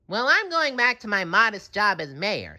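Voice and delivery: high matter-of-fact declaration; leaving with dignity; SpongeBob announcing. A narrator's reading. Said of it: "SpongeBob announced".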